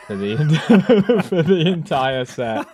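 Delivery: words broken by laughter; laughing. Real, not acted. Laughing through the story.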